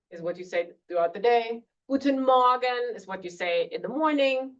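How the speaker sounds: usual speaking voice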